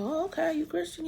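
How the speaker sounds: high, soft incredulous question; mock surprised pastor.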